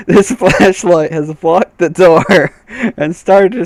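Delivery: slow, chuckling through the sentence; amused, fighting back laughter; laughing. Real, not acted. Narrator amused by the flashlight blocking the door.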